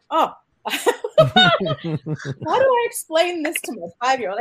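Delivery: laughing, flustered. Real, not acted. Mother recalls her son's question, laughing helplessly. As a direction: giggle out the dilemma, slowly.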